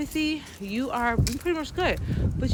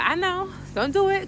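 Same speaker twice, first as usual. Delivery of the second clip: higher, urgent self-warning; a touch of a frantic inner voice; 10 semitones higher than usual. Retold or imitated. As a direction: warn yourself off in a high voice. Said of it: "inner warning".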